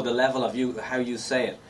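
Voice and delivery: ordinary talk, nothing marked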